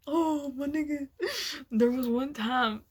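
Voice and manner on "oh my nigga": laughing disbelief. Retold or imitated. Staff watching, laughing.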